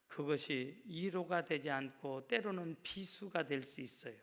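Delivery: ordinary talk, nothing marked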